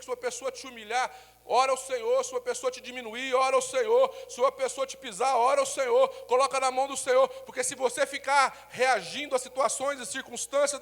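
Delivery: loud, high sermon cadence, hammering each phrase; fiery preaching. Acted. Pastor exhorting the church to pray, loud fiery preaching. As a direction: preach it at full force to the congregation.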